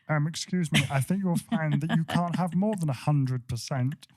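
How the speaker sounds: a hint of a nasal-free nerd correcting the boss; nerdy voice. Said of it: "in a really nerdy voice".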